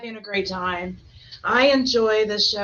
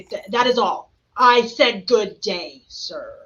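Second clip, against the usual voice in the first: slow, louder, drawn-out brush-off; stern dismissal; 4 dB louder, slower than usual. Acted. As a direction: drag out the dismissal with mock outrage. Quoting a dramatic movie dismissal.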